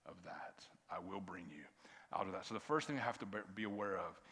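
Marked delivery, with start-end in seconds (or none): 0.0-2.5 hushed, breathy reassurance; God whispering comfort to the listener; soft whisper. Acted. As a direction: whisper it gently, as God's voice. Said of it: "and God says".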